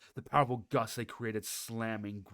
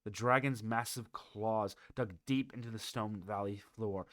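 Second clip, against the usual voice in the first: usual speaking voice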